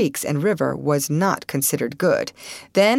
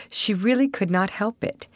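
plain reading voice

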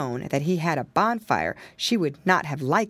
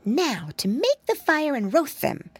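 put-on little pig boy; 7 semitones higher than usual. Piggy boy character voice.

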